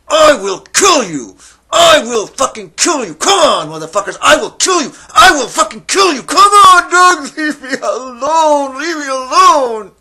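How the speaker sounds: full-force high, desperate scream. Acted. Man fending off dogs in the howling dark, screaming. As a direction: scream threats at the top of your voice. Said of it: "and I started screaming".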